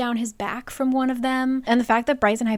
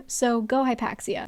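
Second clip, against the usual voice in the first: ordinary delivery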